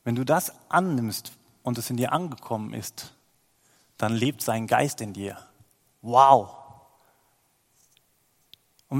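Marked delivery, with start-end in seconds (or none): 6.0-6.6 a loud, delighted exclamation; excited wow. Real, not acted. Preacher marvels at God's spirit living within, loud excited wow. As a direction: burst out in wonder.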